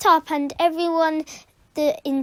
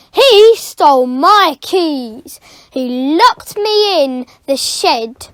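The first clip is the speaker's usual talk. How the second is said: loud, slow furious accusation; slow angry accusation; 10 dB louder, slower than usual. Acted. Caretaker accusing the boy, loud slow anger. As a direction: spell out the crime.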